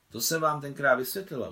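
insistent, attacking reminder. A narrator's reading. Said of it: "útočila Nicole".

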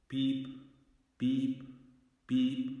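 high, soft steady beeps; imitated radio beep. A narrator's reading. Narrator imitates the satellite's radio signal, beeping.

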